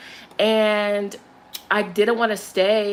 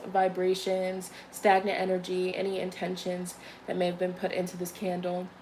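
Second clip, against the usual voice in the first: ritual cleansing; 5 dB quieter than usual. Acted. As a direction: speak it like a quiet prayer to Spirit. Cleansing the candle, ritual words.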